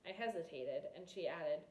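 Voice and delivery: plain reading voice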